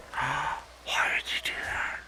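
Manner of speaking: hushed disbelieving whisper; breathy stunned whisper. Real, not acted. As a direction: whisper it in shock.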